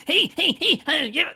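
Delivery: high, loud, manic cackling freak; Chop Top voice. Shown as a demonstration. Putting on the horror voice that freaks her out, manic and high. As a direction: jab out repeated high yelps to creep someone out. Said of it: "I'll do that voice".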